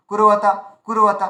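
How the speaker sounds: কুরবাতা run together fast, no gap; quick short syllable. Shown as a demonstration. Teacher shows the short-vowel version, কুরবাতা rattled off in one piece. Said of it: "খুব স্পিডে বলতে হতো".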